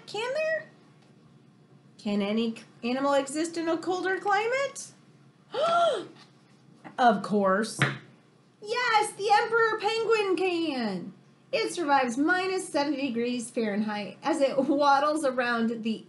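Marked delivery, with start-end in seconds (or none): 0.1-0.7 very high doubting tag question; squeaky disbelieving can there; incredulous question. Acted. Mock doubtful suspense. 5.5-6.1 high mock gasp of surprise; big theatrical surprised gasp; dramatic gasp. Gasp before reveal. 8.6-11.1 higher bright exclaimed answer; thrilled higher reading; excited reveal. Acted.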